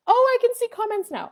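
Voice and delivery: loud, high exclamation; delighted surprise. Real, not acted. Streamer noticing live comments appear, pleasantly surprised. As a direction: burst out with a sudden discovery.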